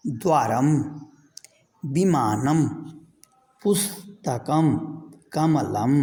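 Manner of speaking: each Sanskrit word said slowly and clearly on its own; model pronunciation. Shown as a demonstration. Teacher to students, modelling each word to repeat back. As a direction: say each word cleanly for the class to copy. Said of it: "मेरे उच्चारण के साथ देखिए".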